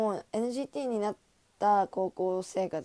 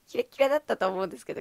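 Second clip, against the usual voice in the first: mock smug; 3 semitones higher, 4 dB louder, faster than usual. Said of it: "みたいな".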